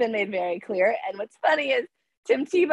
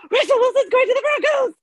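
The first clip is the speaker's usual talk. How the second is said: much higher and louder, bursting with the news; excited announcement; 5 dB louder than usual. Retold or imitated. Telling her boss about the trade, high and excited. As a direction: blurt it out like the best news ever. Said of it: "I was like".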